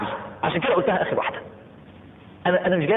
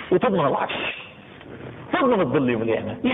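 normal talking voice

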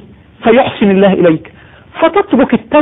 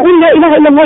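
more urgent urging; 7 semitones higher, much faster than usual. Retold or imitated. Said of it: "يقول له".

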